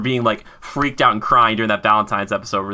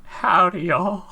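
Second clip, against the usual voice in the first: lightly put-on Southern howdy; a hint of a Texas drawl greeting; mock Southern cowboy hello; Southern accent; 8 semitones higher, much slower than usual. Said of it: "I use a Southern accent".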